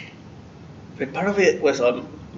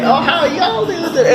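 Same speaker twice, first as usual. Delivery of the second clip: put-on Southern accent; 6 dB louder, faster than usual. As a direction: imitate a thick Southern teacher's greeting. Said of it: "that's how he sounded to me".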